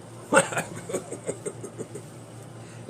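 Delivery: real trailing chuckle; laughing. Real, not acted. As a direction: break into laughter after your own bit. Laughs at own impression.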